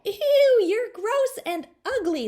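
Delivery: very high, loud disgusted scream; girl screaming at the warty frog. A narrator's reading. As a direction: shriek it in disgust. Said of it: "Linda Lee screamed".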